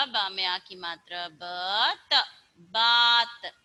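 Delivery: र में आ की मात्रा, then रात said clearly; slow phonics reading. Shown as a demonstration. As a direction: build the word sound by sound. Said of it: "ऐसे करके आपको पढ़ना है". (धीरे-धीरे जोड़कर पढ़ाना)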